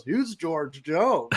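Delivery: drawn-out, deadpan ask; slow mock question. Host quoting the movie's bar patron, slow and drawn out. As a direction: stretch each word out slowly. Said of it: "and he goes".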